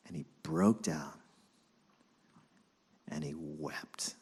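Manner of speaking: slow unvoiced whisper. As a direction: breathe it out in a whisper. Peter weeping, hushed.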